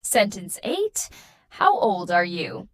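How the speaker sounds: ordinary talk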